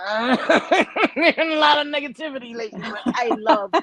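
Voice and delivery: high, quick, giggly delivery; real laughter bubbling under speech; talking through laughter. Real, not acted. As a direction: laugh your way through the words. Breaking up laughing while talking.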